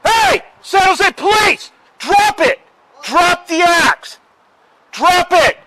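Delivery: furious police shout at full force; shouting commands. Real, not acted. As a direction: bark orders at the top of your lungs. Police officer confronting armed man.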